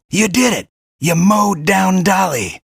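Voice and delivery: high, loud victory cheer; excited cheer. Acted. Guide cheering the kid's win over Dolly, excited. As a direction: whoop with triumph. Cheering after boss defeated.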